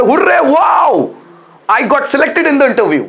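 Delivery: high, slow excited shout; joyful hurray. Shown as a demonstration. Teacher acting joy at being selected, high excited shout. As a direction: cheer it out like you got the job. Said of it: "hurray, मतलब खुशी की feeling".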